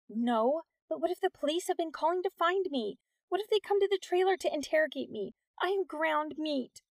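high, fretful worrying; mild panic. A narrator's reading. Nix dreading a police interrogation, panicky. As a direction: let worry push the pitch up, slowing at the end. Girl fears police came looking.

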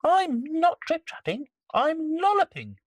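very high little rabbit. Acted. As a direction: speak like a small bouncy rabbit. Rabbit answers troll.

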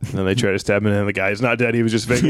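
ordinary delivery

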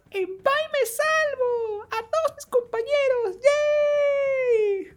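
very high, slow triumphant shouting; triumphant kid shout. Retold or imitated. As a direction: yell it like a kid who won. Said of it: "y decías".

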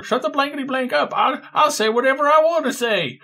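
high squeaky put-on voice, stammering; Mister Stud voice. Hidden character snapping at the player, squeaky put-on voice. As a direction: pitch way up like a cartoon sidekick bickering. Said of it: "a voice that's much lower and quieter going".

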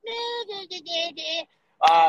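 sing-song refusal; mocking dismissive voice. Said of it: "Some people are like".

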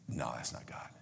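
unvoiced under-the-breath whisper; doubting whisper. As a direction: whisper it in disbelief.

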